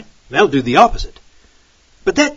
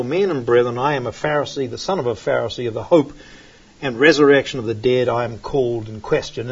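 lower, measured verse reading; scripture reading; 8 semitones lower, 3 dB quieter than usual. Acted. Preacher reading Paul's cry to the council, lower. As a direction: read Paul's words steadily.

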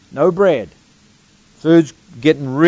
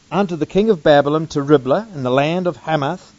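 usual speaking voice

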